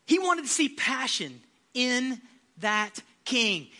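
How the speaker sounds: ordinary talk, nothing marked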